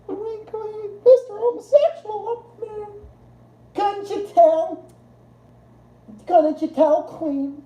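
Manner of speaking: high, slow, campy put-on voice; playful high camp drawl. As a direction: ham up a flamboyant camp voice. Camp act in reply to chat.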